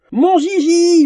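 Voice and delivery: very high, loud squeal; fangirl scream. Acted. Twin screams for her favourite duelist, ecstatic. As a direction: shriek it with adoration. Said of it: "hurla la première".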